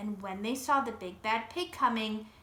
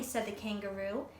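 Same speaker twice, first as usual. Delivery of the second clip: ordinary delivery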